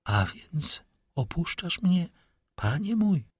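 high, slow, voice swollen with tears; tearful. A narrator's reading. Haydée to the lord who leaves her, tearful. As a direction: ask it haltingly, high and close to tears. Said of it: "rzekła głosem nabrzmiałym od łez".

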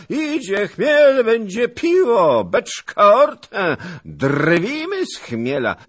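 slow, loud, high bellowing mockery; a bragging shout to the whole camp; boastful shout. A narrator's reading. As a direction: roar it out proudly and threateningly. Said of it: "trzepał pan Zagloba, tocząc groźnie i dumnie".

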